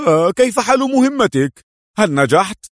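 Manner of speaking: eager, high and loud questioning; keen interest. A narrator's reading. Friend asking the agent about his mission, loud and eager. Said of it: "سأل أدهم في اهتمام".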